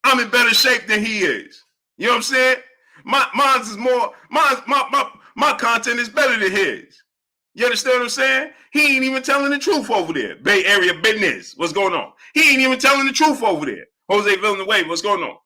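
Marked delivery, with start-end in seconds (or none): 0.0-10.4 high-pitched put-on complainer voice; mocking a rival. Mimics a rival's boasts and complaints. 12.3-13.9 high-pitched put-on complainer voice; mocking a rival. Mimics the rival's complaint between greetings.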